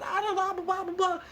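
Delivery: very high squeaky yelp then gabble; hammed-up panicked gibberish; frightened gibberish. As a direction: squeal and babble in terror. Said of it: "terrified for his life".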